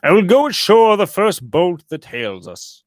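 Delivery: loud, high cry of impatience. A narrator's reading. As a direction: call it out, restless to get off the ship. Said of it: "he cried".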